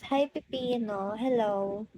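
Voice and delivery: normal talking voice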